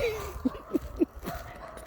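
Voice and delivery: high quiet breathy laugh; soft giggle. Real, not acted. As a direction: giggle to yourself. Laughs at Hagrid line.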